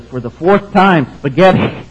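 loud, high, slow and emphatic; fed-up wife repeating her answer; exasperated. Acted. Exasperated wife's punchline.

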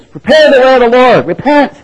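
loud, high prophet's cry. Acted. John the Baptist calling to the crowd, a loud high cry. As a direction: call out like a voice in the wilderness. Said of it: "A voice crying in the wilderness".

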